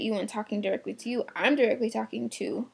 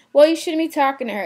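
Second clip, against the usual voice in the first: higher, louder and quick customer retort; indignant customer; 6 semitones higher, 7 dB louder, faster than usual. Retold or imitated. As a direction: snap back as the irritated shopper, fast. Customer snaps back in argument.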